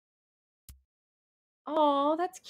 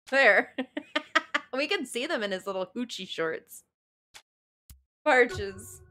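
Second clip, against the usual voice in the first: ordinary delivery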